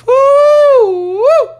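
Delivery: very high, loud whoop; shrieked woo. Acted. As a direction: let out a piercing party whoop. Shows off her accent voice.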